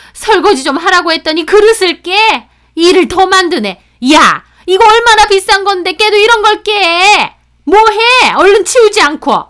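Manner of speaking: high, loud angry tirade; furious scolding. Acted. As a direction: berate her as an enraged mother-in-law. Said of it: "막 화를 내시는데".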